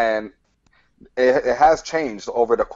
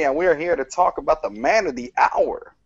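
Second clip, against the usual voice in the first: usual speaking voice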